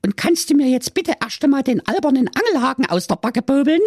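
very high, fast cheeky mermaid voice; sassy mermaid. Acted. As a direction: snap at the prince like a saucy mermaid.

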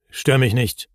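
mildly peevish brush-off; grumpy. A narrator's reading. Busy Hemul to Moomin, mildly grumpy. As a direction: brush them off with some irritation. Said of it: "versetzte der Hemul verdrießlich".

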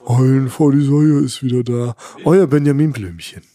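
cartoon elephant impression, drawn out; attempted children's audio-play elephant voice; Benjamin Blümchen. Podcast host surprising listeners with his signature impression, cartoon elephant voice. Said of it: "mit meiner Benjamin Blümchen-Imitation".

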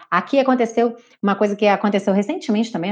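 normal talking voice

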